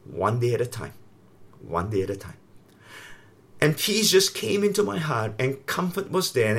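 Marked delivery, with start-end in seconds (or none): 0.0-0.9 lower, softer reassuring words; calm a frightened man gently; quiet comforting voice of God; gentle reassurance. Retold or imitated. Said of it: "he just said this one word to me". 1.6-2.4 lower, much softer repeat; fading, near-whispered reassurance; softer still. Retold or imitated. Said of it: "he just said this one word to me".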